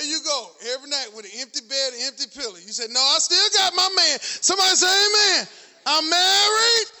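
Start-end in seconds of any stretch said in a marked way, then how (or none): 2.9-4.2 loud, higher, defiant declaration; defiant shout. Acted. Preacher urging widows to declare it, defiant shout. 4.4-6.9 loud, higher, shouted preaching; full-force preaching. Acted. Preacher whipping up the congregation, shouting.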